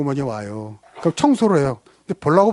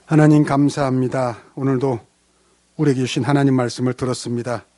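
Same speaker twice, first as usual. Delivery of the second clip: lower, earnest prayer voice; 3 semitones lower than usual. Acted. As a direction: pray sincerely for the congregation. Leads a prayer. (간절한 기도 목소리)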